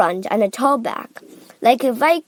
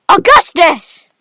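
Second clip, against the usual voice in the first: loud, raised cry of a name; Mister Gloop; 7 semitones higher, 11 dB louder than usual. A narrator's reading. Father crying out to his son, loudly. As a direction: cry out to your child. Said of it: "cried Mister Gloop".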